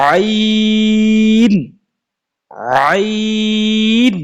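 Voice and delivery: আইন held very long from the throat; drawn-out ain. Shown as a demonstration. Teacher showing students how আইন sounds, long and throaty. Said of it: "উচ্চারণ করার চেষ্টা করি".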